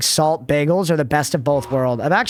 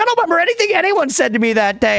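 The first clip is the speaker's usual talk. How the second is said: high, giggly protest; laughing defense; 8 semitones higher than usual. Retold or imitated. Son excusing himself to stepmom, high and laughing. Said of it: "I was like".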